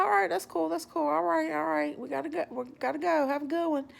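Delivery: high-pitched, repeated placating exit; polite brush-off. Retold or imitated. Walker trying to leave the stranger, polite brush-off. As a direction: humour him while edging away, voice lifted.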